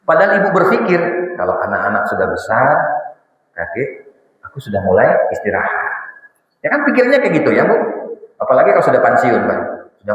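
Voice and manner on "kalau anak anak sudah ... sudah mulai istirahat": lower, wistful daydreaming; hopeful wife. Acted. Preacher voicing a wife hoping to rest once the children are grown, wistful. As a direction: dream aloud about finally resting.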